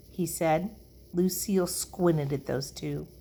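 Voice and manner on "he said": plain reading voice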